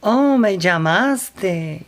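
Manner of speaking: slow, drawn-out Spanish "Oh, me llamaste" with a rising lift. Retold or imitated. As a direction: say "me llamaste" in Spanish, slowly and pleased. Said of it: "and I said in Spanish".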